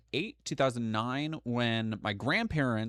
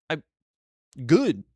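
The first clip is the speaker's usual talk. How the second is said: ordinary delivery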